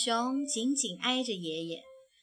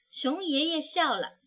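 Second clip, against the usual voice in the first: usual speaking voice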